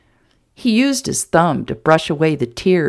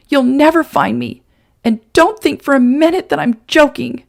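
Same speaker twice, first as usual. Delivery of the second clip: higher, louder angry woman; angry threat; 6 semitones higher, 4 dB louder than usual. Acted. Woman threatening the man to keep her secret. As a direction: warn him hard, meaning every word.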